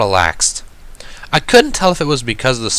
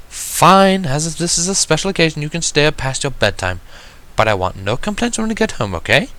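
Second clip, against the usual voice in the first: higher, refined lady gently correcting; a poised unicorn gently reminding her sister; Rarity's voice; 7 semitones higher than usual. Acted. Rarity reminding Sweetie Belle, higher and refined.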